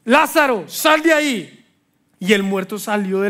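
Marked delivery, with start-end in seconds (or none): 0.1-1.7 louder, higher call into the tomb; Jesus calls Lazarus out of the tomb, raised call. A narrator's reading. Call the dead man out. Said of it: "Jesús gritó".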